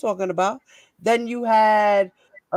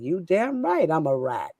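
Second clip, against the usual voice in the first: ordinary talk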